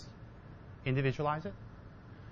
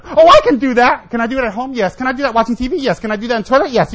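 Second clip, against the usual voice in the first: mock eager student; 9 semitones higher, 14 dB louder than usual. Eager student questions, teacher answers.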